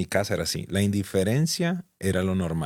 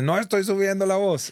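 high-pitched, mildly raised retold shout; mock yelling; 11 semitones higher than usual. Husband quotes his wife denying she shouts, mock yelling. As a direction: imitate someone yelling in denial, voice jumping up. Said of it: "ella gritándome me dice".

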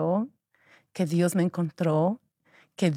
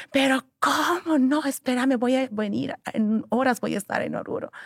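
higher pleading father's voice; worried father; 7 semitones higher than usual. Retold or imitated. Quoting her father on the phone, higher and pleading. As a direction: plead as her anxious dad.

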